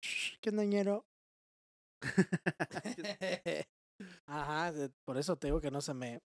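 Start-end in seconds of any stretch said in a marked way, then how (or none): 0.0-1.0 higher, street-slang chilango voice; chilango accent. Retold or imitated. S2 shows his friend the chilango way of talking. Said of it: "el chilango". 2.0-3.6 warm laugh at his own joke; short laugh. Real, not acted. After his chilango impression, he laughs at himself.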